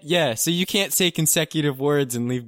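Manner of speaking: loud exasperated complaint. Real, not acted. Complaining about the recording.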